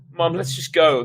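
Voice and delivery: put-on high, loud kid pleading; urging boy. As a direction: tug her along. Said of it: "the boy with the clown face urged".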